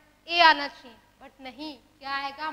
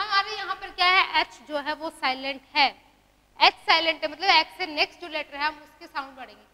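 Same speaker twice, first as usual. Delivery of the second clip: ordinary delivery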